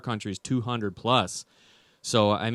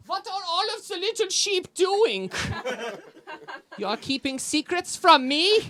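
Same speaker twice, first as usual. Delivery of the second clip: put-on much higher, German-accented interrogator; mock German shepherd. Shepherd interrogating his sheep, high mock German accent. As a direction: bark it like a suspicious German officer. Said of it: "what a German shepherd is like".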